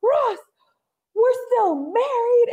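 high-pitched, drawn-out mock outburst; Rachel storming in. Cohost acts out Rachel storming into the lecture, high mock outburst. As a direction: imitate a woman bursting in with news, pitch way up. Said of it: "But then here storms in Rachel".

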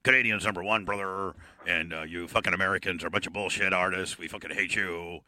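low wrestler's boast; gimmick heavy breather. Pretend. Canadian caller taunting Americans, low gimmick wrestler voice. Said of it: "I sound like this all the time".

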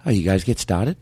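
attempted Boston accent; practising a Boston accent in speaking voice. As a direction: copy the coach's Boston accent as a question. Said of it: "let's practice our Boston accent".

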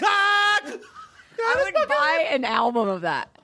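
laughing through the words, slow and high; amused giggling delivery. Real, not acted. Laughing after the parody song.

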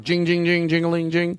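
ice cream truck jingle. Retold or imitated. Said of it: "you hear the ice cream truck".

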